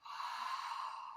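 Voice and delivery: long, soft exhale; breathing out. Shown as a demonstration. Teacher showing kids deep breaths, long exhale. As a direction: let a long breath go through the mouth. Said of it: "And breathe out".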